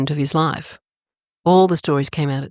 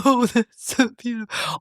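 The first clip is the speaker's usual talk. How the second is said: high, teary gush over beauty, half laughing; mock tearful gushing, pitched up; imitating her younger self welling up at something lovely; happy tears; 7 semitones higher than usual. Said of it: "I would cry when I was happy".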